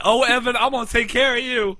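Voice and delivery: loud, high-pitched sassy woman; hammed-up sassy lady coming to the rescue. Sassy lady comforting a lost little kid in a store, hammed-up sass. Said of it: "Looking for some sassy lady".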